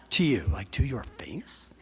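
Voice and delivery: a hushed, scandalised whisper with a laugh at the end; shocked whisper. Acted. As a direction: whisper it in mock disbelief, voice dropping away. Acting shocked listener to Carrie.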